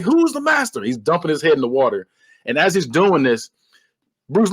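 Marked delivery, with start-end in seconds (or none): 0.0-0.8 high-pitched mock challenge; villain's demand. Retold villain demanding submission.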